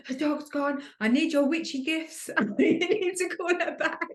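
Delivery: high, laughing retelling of a frantic call; mock panic. Sister replaying her frantic call about the lost dog, high and laughing.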